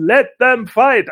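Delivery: loud, slow ringside shout. Retold or imitated. Rhea Ripley yelling at the brawl from ringside, loud slow shout. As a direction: yell it from outside the ring. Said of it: "schreit Rhea Ripley von draußen".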